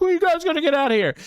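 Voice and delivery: high, fast mock griping; imitating an angry rant. Actor bitching at the detectives, high mock rant. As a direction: gripe in a strained high pitch, hurriedly. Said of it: "bitching at the detectives".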